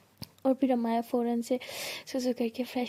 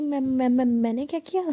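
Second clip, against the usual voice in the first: stammering, louder, caught off guard; flustered protest; 6 dB louder than usual. Acted. Maya flustered by her friend's teasing, louder stammer. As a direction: sputter like you've been caught.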